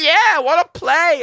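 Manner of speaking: high, loud, slow yelp of a playful puppy; excited puppy voice. Retold or imitated. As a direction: shout it like a dog begging to play. Voicing the excited young dog.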